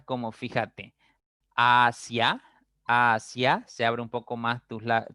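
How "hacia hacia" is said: "hacia" split slowly, rising onto the final A; slow pronunciation demo. Shown as a demonstration. Teacher showing the I-A diphthong, "hacia" slowly rising. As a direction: stretch "hacia" and lift the ending. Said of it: "al momento de pronunciar la A".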